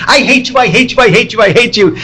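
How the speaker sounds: high, sing-song kid mockery of a soap line; mocking soap opera. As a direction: tease it like kids aping a melodrama. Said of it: "used to make fun of".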